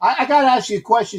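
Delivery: New York Bronx voice; Bronx accent. Retold or imitated. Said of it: "'cause I'm trying to do the Bronx accent".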